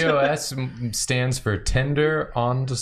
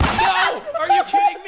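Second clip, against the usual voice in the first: shocked outcry. Real, not acted. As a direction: shriek it in real disbelief. Card reveal, outraged disbelief.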